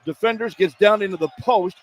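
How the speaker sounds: running call of the drive and foul; live play-by-play. Real, not acted. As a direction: narrate the play as it happens.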